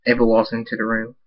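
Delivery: ordinary delivery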